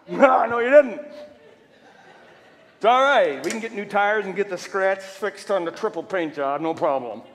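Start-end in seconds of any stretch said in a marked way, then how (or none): 0.1-3.4 loud, laughing reply; gloating taunt. Real, not acted. Preacher taunting the vandals who keyed his car, loud.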